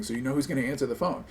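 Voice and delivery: normal talking voice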